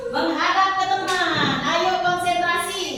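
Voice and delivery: high, lively classroom leader; kindergarten teacher voice. Real, not acted. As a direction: coax the class along brightly. Teacher leads the class chant. (suara guru TK yang ceria)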